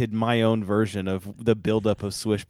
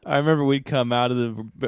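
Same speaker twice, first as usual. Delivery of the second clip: ordinary talk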